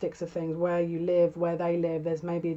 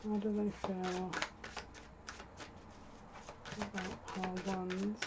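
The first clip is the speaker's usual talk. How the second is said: hushed; 13 dB quieter than usual. Real, not acted. Repeats softly, slow, to the cards.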